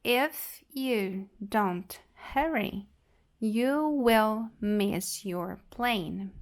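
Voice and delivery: "If you don't hurry, you will miss your plane" said at half speed; very slow model sentence. Shown as a demonstration. Teacher repeating the sentence very slowly for learners. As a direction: crawl through each word.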